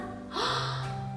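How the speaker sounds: high breathy gasp of delight. Acted. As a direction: gasp as if amazed. Teacher gasps in delight.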